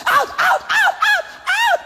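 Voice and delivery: mock an over-eager exorcist screeching the word; imitated frantic shouting. Mimicking people who don't know what they carry, frantic shouting. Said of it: "will now begin to shout".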